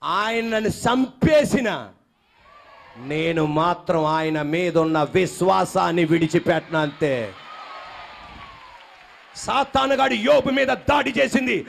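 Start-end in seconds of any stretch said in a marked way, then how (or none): 0.0-7.3 slow, much lower resolute vow; the congregation repeating Job's vow, slow and low; said loudly. Shown as a demonstration. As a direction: drag it out low and firm. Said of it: "ఇప్పుడు గట్టిగా అనాలి".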